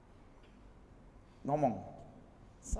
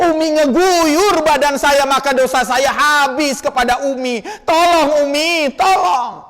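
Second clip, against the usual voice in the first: pleading spirit; 5 semitones higher, 7 dB louder than usual. Acted. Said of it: "Ruhnya akan ngomong".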